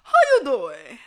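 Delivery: a bolder go at the Wendy Williams greeting; hamming up the Wendy Williams greeting for viewers; Wendy Williams impression. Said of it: "put on my Wendy Williams voice".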